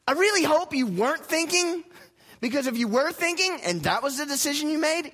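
high, pressed exasperated voice; lightly voiced exasperated scolder; mock scolding. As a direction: build to the insult in a fed-up tone.

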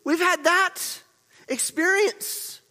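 loud, high-pitched preacher; full-force preaching. Real, not acted. Preacher telling church they've had that experience, full-force preaching. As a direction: preach it out to the whole room.

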